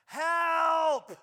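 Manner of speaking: a full-throated, high cry of Help!; a desperate call for help across the battlefield; yell for help. Shown as a demonstration. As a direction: shout it out like you're surrounded. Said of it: "all right, and just yell".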